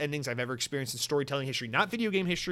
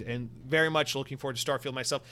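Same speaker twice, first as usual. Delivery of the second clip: usual speaking voice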